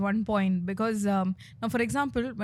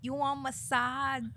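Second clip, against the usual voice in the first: slow, higher Thai-accented offer; 4 semitones higher, slower than usual. Shown as a demonstration. Comedian plays a vendor offering a massage, Thai accent. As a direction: stretch the words in a put-on Thai accent. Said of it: "What is Thailand accent?".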